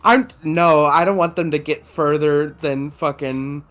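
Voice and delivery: ordinary talk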